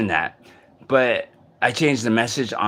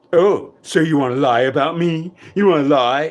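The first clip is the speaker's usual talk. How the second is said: higher, louder mocking accusation; accusing voice; 4 semitones higher, 4 dB louder than usual. Imitating the voice accusing him of lying, mocking. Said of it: "now it's all like".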